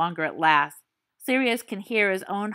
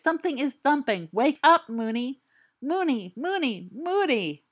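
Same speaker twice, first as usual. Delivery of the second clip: higher, insistent pleading; pleading urgency; 7 semitones higher than usual. Acted. Sirius begs drowsy Remus to wake up, anxious and high. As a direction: keep calling him, anxious.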